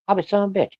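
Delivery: high squawky bird voice; a crow that suddenly swears; talking crow. Retold or imitated. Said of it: "He actually talked".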